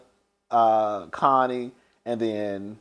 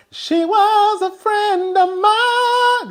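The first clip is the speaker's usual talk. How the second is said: much higher, slow, put-on voice; imitating Nadia; 4 dB louder, much slower than usual. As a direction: stretch it out in a high mocking impression. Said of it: "who sound like she on some".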